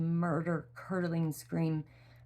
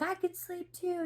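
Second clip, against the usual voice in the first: much higher, squeaky playful copy; mock child voice. Mother joining the late-night joke, squeaky high voice. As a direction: mom joining the silly game up high. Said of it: "And then I'm like".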